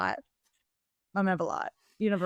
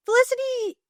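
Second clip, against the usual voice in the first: mock dramatic; 3 dB louder than usual. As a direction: sing her name up high. Mock-dramatic naming of the heroine.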